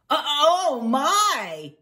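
loud, high startled beagle; taken aback. Acted. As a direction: exclaim in flustered surprise. Beagle startled to see a cat.

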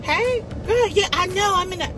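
put-on breezy, busy girlfriend chat on the phone; chirpy phone chat. Acting out her own phone call.

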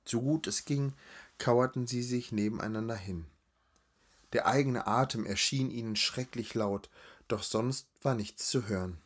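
ordinary delivery, nothing marked

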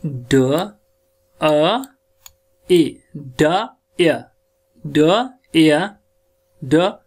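डायमेट्रिकली broken into single sounds, long pauses; sounding out. Shown as a demonstration. Teacher building the word slowly for students. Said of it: "डायमेट्रिकली".

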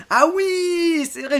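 high, loud 'oh yes' of remembering; sudden realisation. Real, not acted. Host remembering the monthly giveaway, sudden realisation. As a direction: it comes back to you, pitch and volume jump.

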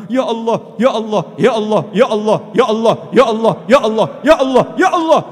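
loud repeated cries of supplication; insistent acted plea to God; begging God. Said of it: "sudahkah kita mengemis seperti itu".

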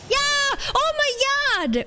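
very high-pitched real shriek; excited scream. Real, not acted. Player wins the guess and shrieks, excited scream. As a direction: scream out in sudden joy, way up high. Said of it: "förlåt för att jag skrek".

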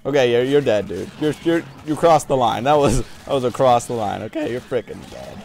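mock threat. Real, not acted. Angry at enemy player.

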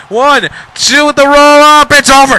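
high, loud burst at the near fall; excited yell. Real, not acted. Commentator shouting a near fall, high and loud. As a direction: call it like the match just ended.

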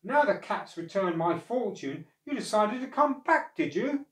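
acted old man's reproach; indignant accusation. A narrator's reading. Old man scolds returning dog.

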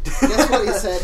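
loud, laughing through the line. Acted. Reading the fanfic to cohost, loud and laughing. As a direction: crack up while reading.

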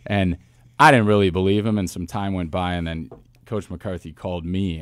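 normal talking voice

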